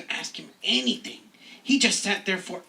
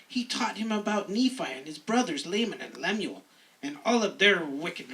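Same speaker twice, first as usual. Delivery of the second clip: ordinary talk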